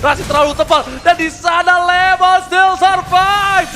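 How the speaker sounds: rising hype, loud and high; excited esports caster. Real, not acted. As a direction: call the fight with mounting energy. Hype caster call of a fight.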